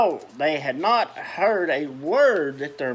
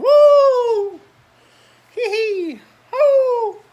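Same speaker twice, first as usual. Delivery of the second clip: very high, loud howl and hoot; child howling at the moon, high and loud; howling whoops; 12 dB louder, much slower than usual. A narrator's reading. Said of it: "She howled at the moon".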